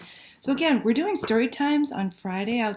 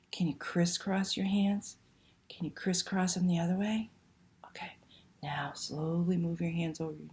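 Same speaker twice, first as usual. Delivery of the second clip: whisper; 2 semitones lower, 8 dB quieter than usual. Real, not acted. Librarian whispering the hand game to the children, soft whisper. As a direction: hush it like a secret game.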